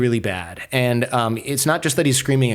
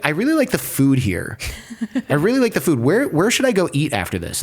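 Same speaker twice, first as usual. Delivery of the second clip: higher, mock-cheerful small talk about food; feigned innocence; 4 semitones higher than usual. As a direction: act breezily clueless to throw them off. Playing innocent with interrogators.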